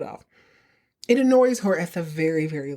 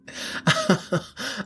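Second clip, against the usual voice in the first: light laugh; 4 semitones higher than usual. Real, not acted. Laugh over New Yorker joke.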